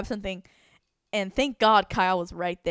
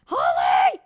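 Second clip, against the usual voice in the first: mock panic shout; 9 semitones lower, 4 dB louder, slower than usual. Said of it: "been like".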